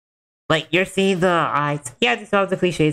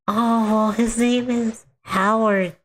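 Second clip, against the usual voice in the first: put-on higher child voice, slowing on the name; higher child's voice telling her mom about the ghost; creepy little girl; 7 semitones higher than usual. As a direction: innocent kid telling something eerie. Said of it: "the little girl's like".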